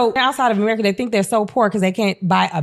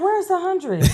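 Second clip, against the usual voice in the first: higher-pitched, puzzled demand; mock dismay; 8 semitones higher, 3 dB quieter than usual. Comedian voices a worker seeing the shrunken check, mock dismay. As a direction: act out a baffled worker seeing the paycheck.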